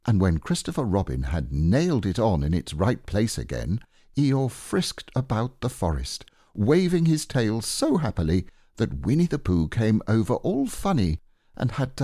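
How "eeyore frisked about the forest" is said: plain reading voice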